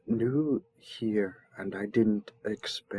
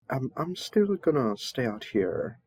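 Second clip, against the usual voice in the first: ordinary talk, nothing marked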